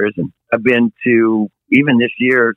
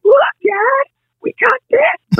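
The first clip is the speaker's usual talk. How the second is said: imitating a kid's excited cry; slower than usual. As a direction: show it off like a thrilled small child. Kids showing off bedroom.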